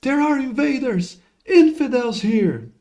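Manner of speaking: high, loud cry of alarm; alarmed shout. Acted. Young soldier warns his sire of invaders, alarmed shout. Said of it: "he turns around and shouts".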